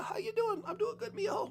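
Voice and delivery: put-on sweet old aunt greeting her nephew; lightly high, soft auntie voice; Auntie Tia. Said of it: "Make me think about Auntie Tia".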